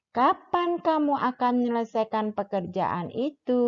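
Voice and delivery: "Kapan" stressed, then the pitch falls to the end on "itu"; falling question intonation. Shown as a demonstration. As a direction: ask it and let the voice drop on "itu". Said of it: "memakai intonasi yang menurun". (intonasi menurun, tekanan pada "Kapan", turun di "itu")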